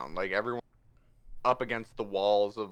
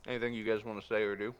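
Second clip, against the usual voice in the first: talking while crunching chips; 5 dB quieter, much faster than usual. Real, not acted. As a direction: mouth full of chips. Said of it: "Sorry, eating chips".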